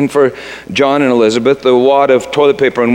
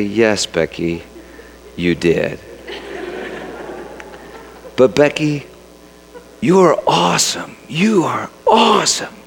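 tender reassurance; 5 dB quieter than usual. Retold or imitated. Dad comforting his daughter.

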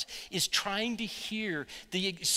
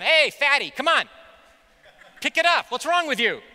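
loud, high barking taunt at a struggling husband; mock harsh taunt; 10 semitones higher, 12 dB louder than usual. Wife yelling at her husband from the boat, mock harsh taunt. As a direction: yell it like a mean coach. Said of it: "She's not in the back of the boat going".

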